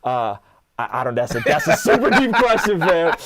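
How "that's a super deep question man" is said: high, loud laughing reply. Real, not acted. Laughs at the question.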